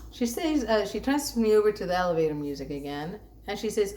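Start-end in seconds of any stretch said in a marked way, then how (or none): none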